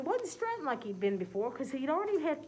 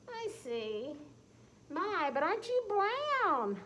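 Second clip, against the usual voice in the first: slow, higher catty drawl; sly cat, teasing; 9 semitones higher, slower than usual. Acted. Cat character speaks.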